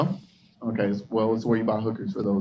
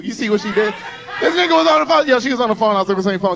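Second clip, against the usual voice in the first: much higher, loud incredulous call; calling out a woman on her phone, loud and high; excited call-out; 10 semitones higher, 6 dB louder, faster than usual. Real, not acted. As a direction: shout it in disbelief at her.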